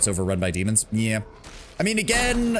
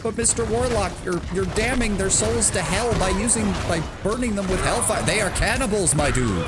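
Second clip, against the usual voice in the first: a higher, mock-indignant character voice; lightly voiced character; 9 semitones higher than usual. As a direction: play a scandalized companion, lightly. Voicing a dialogue between characters.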